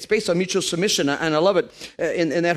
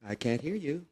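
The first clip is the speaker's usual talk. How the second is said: God pretending not to hear; 3 semitones lower, 13 dB quieter than usual. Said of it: "He goes".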